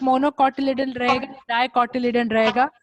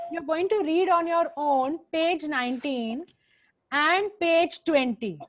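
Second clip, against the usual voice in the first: slow dictation; slower than usual. Shown as a demonstration. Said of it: "repeating homework slowly".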